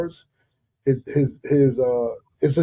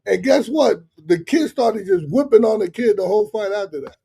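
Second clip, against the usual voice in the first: higher, louder and fired up; excited retelling; 6 semitones higher, 6 dB louder than usual. Real, not acted. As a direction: relive the moment, all worked up. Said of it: "I'm going crazy".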